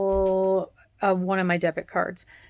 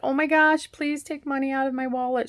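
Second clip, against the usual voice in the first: higher, eager and pleading; grateful excitement; 6 semitones higher than usual. Retold or imitated. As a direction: gush with relief and thanks. Thrilled wallet was found.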